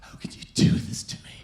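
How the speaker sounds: imitating the weeping girlfriend; mock crying plea. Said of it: "a woman's just crying".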